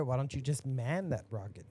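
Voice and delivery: ordinary delivery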